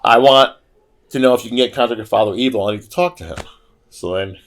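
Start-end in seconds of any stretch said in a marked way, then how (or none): 0.0-3.4 insistent. Retold or imitated. Lita telling the coffee CEO who she is, emphatic. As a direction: insist firmly on who you are. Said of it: "She goes".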